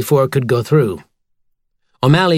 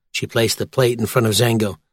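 ordinary delivery

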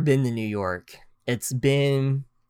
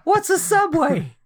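put-on confused country boy; 4 dB louder, slower than usual. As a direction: ask it like a total bumpkin.

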